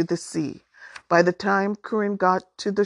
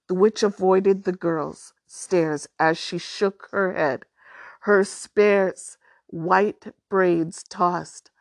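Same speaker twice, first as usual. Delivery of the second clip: usual speaking voice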